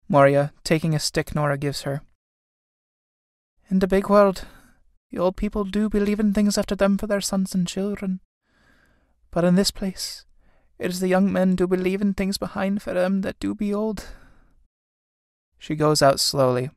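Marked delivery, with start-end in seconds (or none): 3.7-14.1 higher, subdued, resigned lament; sorrowful old mother. Acted. Old mother takes the stick, higher subdued lament. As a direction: muse sadly as a bereaved old woman.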